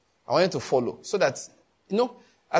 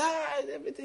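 a mock complaining aunt, higher; imitating auntie; 10 semitones higher, 8 dB quieter than usual. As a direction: lightly voice the weary auntie. Pastor voices aunt complaining.

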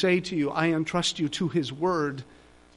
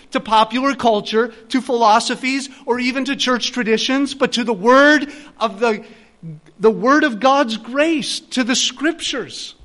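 louder, emphatic pulpit voice; preacher pressing hard, voice raised; forceful preaching; 5 semitones higher, 7 dB louder than usual. Acted. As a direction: drive the point home to the congregation. Preaching with rising intensity.

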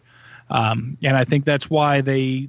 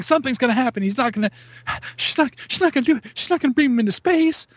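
fast, much higher pitched panicky insisting; frantic denial; 10 semitones higher, much faster than usual. Retold or imitated. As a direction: rattle it off in rising panic. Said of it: "I'm like, I'm, like, sitting there".

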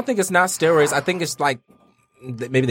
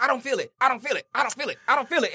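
high whining repeat, imitating her; whiny impatient friend; 8 semitones higher, 4 dB quieter, much faster than usual. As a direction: mimic her nagging that nothing's working. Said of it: "no, you was like".

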